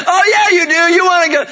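high, loud dad's insistence; insistent coaxing. Retold or imitated. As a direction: override the protest with forced enthusiasm. Dad overriding daughter's protest.

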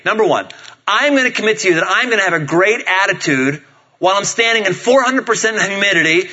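high-pitched, mock-solemn dad's pledge; emphatic bargaining. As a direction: lay down the family terms with energy. Bargaining hard with his kids.

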